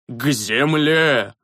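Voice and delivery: slow commanding shout; shouting orders. A narrator's reading. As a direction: bark it out to get down. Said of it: "продолжал кричать старый священник".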